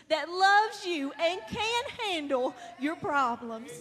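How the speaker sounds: impassioned, rising preaching voice; fervent preaching. Real, not acted. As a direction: preach it out to the congregation. Preaching at full force.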